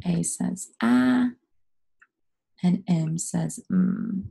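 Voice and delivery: slow, with "ah" and "mm" held long; name each letter, then stretch "ah" and "mm"; letter name, then sound. Shown as a demonstration. Teacher spelling away with pupils, slowly holding each sound. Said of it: "Spell the word away with me".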